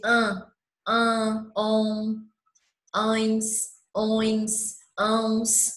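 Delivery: "an", "on", "ãos" through the nose; nasal sound demo. Shown as a demonstration. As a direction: hum each nasal vowel clearly. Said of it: "Quando nós vamos fazer a pronúncia".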